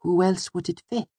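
high, patient little voice; Auri. A narrator's reading. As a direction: point out the obvious, pitched high. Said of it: "as if explaining something to a child".

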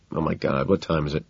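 ordinary delivery

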